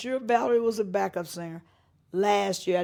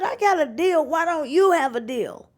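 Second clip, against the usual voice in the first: high, louder boastful husband; boasting husband; 9 semitones higher, 8 dB louder than usual. Retold or imitated. Husband bragging to wife about his deal, high boastful voice. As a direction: brag it up loudly.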